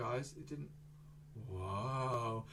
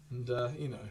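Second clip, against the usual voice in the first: usual speaking voice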